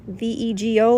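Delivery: V E G O spelled letter by letter. Shown as a demonstration. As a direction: spell V E G O one letter at a time. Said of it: "like Lego, but Vego".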